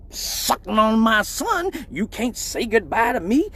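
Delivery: high mocking mom scolding; high hammed-up mom taunt. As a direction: tease like his mom catching them leaving.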